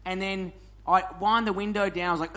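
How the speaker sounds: normal talking voice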